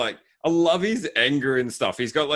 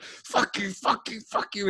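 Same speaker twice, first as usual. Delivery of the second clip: higher, hammed-up angry character voice; mock-rage repeated curse; imitating the anger; 9 semitones higher, 5 dB quieter, slower than usual. Retelling the actor raging at the screen, mock anger. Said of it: "There's so much anger".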